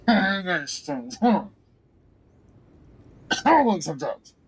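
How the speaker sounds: high, strained theatrical voice; hammed-up drama. Player giving in to drama to offer drug hauling, hammed-up. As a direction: draw it out high with mock drama. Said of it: "let's give in to drama".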